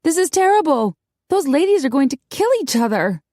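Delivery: high-pitched, dismayed outburst; distressed. A narrator's reading. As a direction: lament it as a looming disaster. Distressed woman collapses into a chair.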